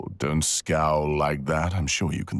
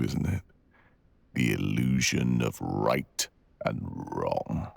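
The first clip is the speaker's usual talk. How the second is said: ordinary talk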